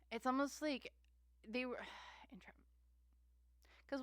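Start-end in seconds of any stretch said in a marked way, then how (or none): 1.5-2.5 fast, hushed, unvoiced trailing whisper; soft whisper. Real, not acted. Reader whispers a half-thought to viewers. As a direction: let it slip out in a whisper. Sighs and drops voice.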